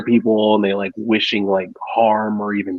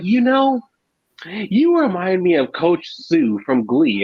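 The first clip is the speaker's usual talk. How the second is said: classmate voice; 7 semitones higher, slower than usual. Retold or imitated.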